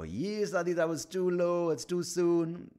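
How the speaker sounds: high, loud mock protest; objecting listeners. Listeners objecting to the tasteless ad joke, mock protest. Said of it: "for you to go".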